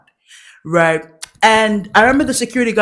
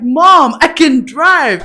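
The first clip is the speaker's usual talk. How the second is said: laughing protest; 6 semitones higher, 5 dB louder, much slower than usual. Retold or imitated. Protesting to mom.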